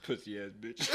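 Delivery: ordinary delivery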